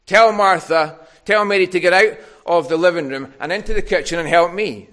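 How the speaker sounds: demanding complaint. Retold or imitated. Martha telling Jesus what to do, loud and demanding. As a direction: order him about like a put-upon host. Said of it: "for Martha to say to Jesus".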